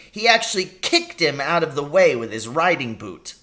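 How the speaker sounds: put-on lively old country doctor, telling a tale with relish; doctor relishing the story, lightly voiced; Doc Spencer voice. Doctor tells the story in role.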